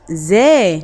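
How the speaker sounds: زا said as a clear za with open a; letter sound demo. Shown as a demonstration. Teacher showing children the fatha sound زا. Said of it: "زي فتحة".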